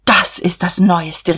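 slow, astonished exclamation; calling out. A narrator's reading. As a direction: exclaim it drawn out. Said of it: "riefen Emmy und Magda".

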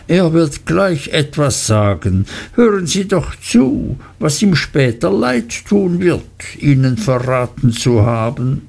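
slippery voice backing down; placating Uriah. Acted.

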